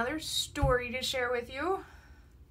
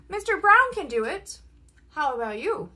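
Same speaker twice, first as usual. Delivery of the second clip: plain reading voice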